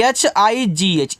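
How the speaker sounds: drawn-out letter spelling; slow spelling. Shown as a demonstration. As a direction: spell एच आई जी एच out slowly. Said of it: "इसको पढ़ते हैं हाई".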